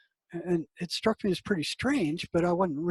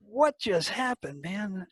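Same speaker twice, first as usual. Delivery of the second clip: slower and higher, dazed disbelief; stunned; 4 semitones higher, slower than usual. Retold or imitated. Counselor sitting stunned after the voices spoke, dazed. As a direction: ask it as if the floor just gave way. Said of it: "I, I was so stunned".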